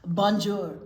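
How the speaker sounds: put-on lightly French-accented Bonjour; French greeting. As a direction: greet like a suave French snail. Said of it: "That's French as well".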